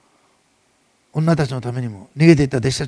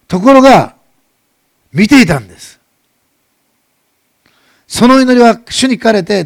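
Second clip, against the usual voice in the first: full-force preaching; 6 semitones higher, 10 dB louder than usual. Acted. Preaching climax, loud and emphatic.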